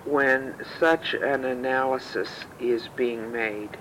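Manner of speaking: normal talking voice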